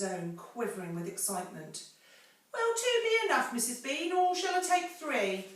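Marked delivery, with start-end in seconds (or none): 2.5-5.5 very high, loud servant's question; Mabel calling upstairs. Acted. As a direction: servant calling up to her mistress. Mabel asks up the stairs.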